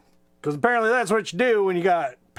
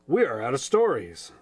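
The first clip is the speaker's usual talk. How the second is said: a tired father's mild reproach, low; papa chicken; 6 semitones lower than usual. Acted.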